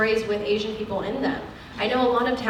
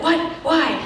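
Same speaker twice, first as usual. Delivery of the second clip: short, higher-pitched burst of self-mocking indignation; mock angry; 7 semitones higher, 3 dB louder than usual. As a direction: lightly act out getting angry.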